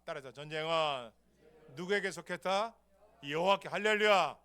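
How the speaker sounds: loud fervent preacher's cry; preaching at full force. Acted. Preacher shouting to the congregation, fervent. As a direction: call it out to the congregation.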